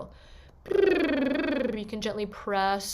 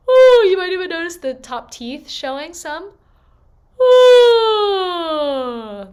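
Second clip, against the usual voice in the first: high open yawn, loud ooh; 8 semitones higher, 14 dB louder, slower than usual. Shown as a demonstration. Coach demonstrating the yawn for students, high yawning ooh. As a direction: let a yawn pull the sound up. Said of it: "like you're yawning".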